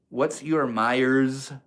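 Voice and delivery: slow, careful question to chat; asking chat slowly so nobody can trick him; deliberately slow. Real, not acted. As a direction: stretch each word out on purpose. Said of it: "I said that deliberately slowly".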